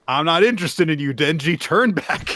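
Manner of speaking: high, loud, fast villain taunt; hammed-up Yoru. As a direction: sneer it up high, quick and dismissive. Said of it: "Yoru objects to this by saying".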